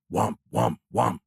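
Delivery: droning alarm "womp", evenly repeated; imitated alarm. A narrator's reading. Cockpit alert drills into the pilot's brain, imitated alarm. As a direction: voice a cockpit warning tone, pulse by pulse. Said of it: "the incessant alert blaring".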